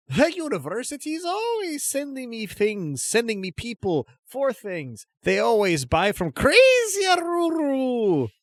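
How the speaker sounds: high, theatrical huckster voice; eccentric merchant voice. Acted. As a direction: play a wild market trader. GM voices Crazy Aruru.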